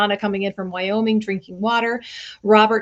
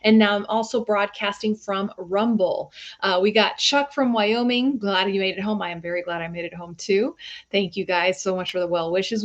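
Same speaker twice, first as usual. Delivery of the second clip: normal talking voice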